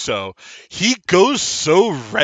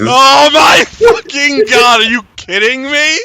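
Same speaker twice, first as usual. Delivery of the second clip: exasperated disbelief; 10 semitones higher, 10 dB louder than usual. Real, not acted. Game master reacts to lucky roll.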